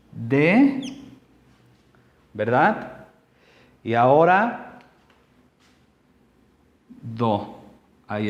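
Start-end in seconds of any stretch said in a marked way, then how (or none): 0.1-0.8 "de" alone, drawn out and rising; isolated syllable. Shown as a demonstration. Teacher dividing dedo into syllables, "de" isolated and rising. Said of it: "si la quiero dividir en sílabas". 6.9-7.6 "do" alone, low and soft; isolated syllable. Shown as a demonstration. Teacher finishing the division of dedo, "do" isolated, low and soft. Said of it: "si la quiero dividir en sílabas".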